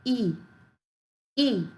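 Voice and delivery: ई as the vowel sound of I; phonics I sound. Shown as a demonstration. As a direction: sound out ई alone for letter I. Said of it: "आई का sound क्या होता है". (ई, अक्षर I की ध्वनि)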